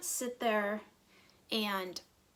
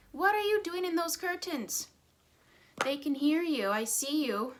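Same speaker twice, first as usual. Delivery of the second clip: higher, louder call across the room; calling to the cat; 6 semitones higher, 4 dB louder than usual. Real, not acted. Mom calling to the cat rolling in the curtains.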